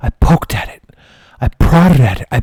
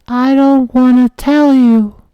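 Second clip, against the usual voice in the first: put-on much higher innocent kid voice; high kid fibbing to firemen; little kid voice; 11 semitones higher, slower than usual. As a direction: play dumb like a small child. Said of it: "And I said".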